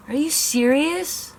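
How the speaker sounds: high, slow, stretched-out disbelief; exasperated disbelief. Real, not acted. To the stuck curling iron, exasperated disbelief. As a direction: pull it up high in fed-up shock.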